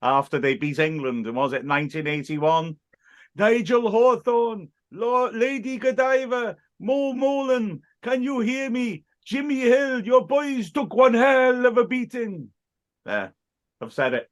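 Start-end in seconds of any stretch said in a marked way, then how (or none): 3.4-12.5 high, slow, ecstatic commentator yell; Norwegian commentator impression. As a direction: channel a frenzied football commentator. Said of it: "channeling the spirit of Norwegian football commentator Bjørge Liljen".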